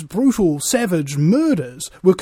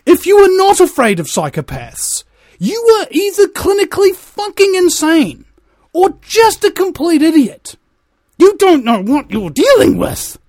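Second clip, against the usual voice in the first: loud, high-pitched angry outburst; heated rant; 8 semitones higher, 8 dB louder than usual. Real, not acted. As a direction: shout an impassioned warning at the listeners. Heated warning rant about psychopaths.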